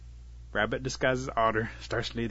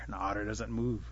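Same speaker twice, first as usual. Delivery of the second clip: ordinary delivery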